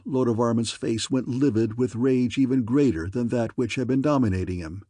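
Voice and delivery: ordinary delivery, nothing marked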